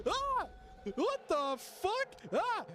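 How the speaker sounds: high, drawn-out cries of disbelief; high, slow, startled exclaiming; shocked yelps. Real, not acted. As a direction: yelp and stretch the words in shock. Shocked by thing in game.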